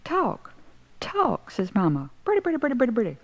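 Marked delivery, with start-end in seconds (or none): 0.0-1.5 very high, falling one-word call. A narrator's reading. Mother repeats it up the stairs. 2.2-3.2 very high, tumbling repetition; rapid chatter. A narrator's reading. Mimicked chatter after the shouted word.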